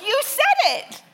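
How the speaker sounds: high, loud, exasperated retort; mock exasperated. Father to son refusing to repeat word, exasperated high retort. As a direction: snap back in disbelief. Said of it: "I'm like".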